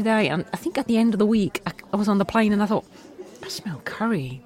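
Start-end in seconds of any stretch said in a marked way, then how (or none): 3.4-4.4 hushed whisper, mock confession; whispered aside. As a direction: whisper it like a guilty secret. Retold thought on plane.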